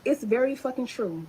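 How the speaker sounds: ordinary delivery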